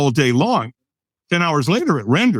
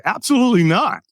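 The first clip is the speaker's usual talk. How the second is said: higher-pitched, emphatic retort; emphatic refusal; 6 semitones higher than usual. Retold or imitated. Storyteller turning down the stranger's fire walk invitation, emphatic refusal. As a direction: refuse it outright, pitch jumping up. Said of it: "I said it with that kind of tonality".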